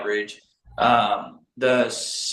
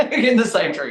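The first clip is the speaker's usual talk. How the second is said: laughing; 9 semitones higher, 3 dB louder than usual. Real, not acted. Proud punchline of hunting story.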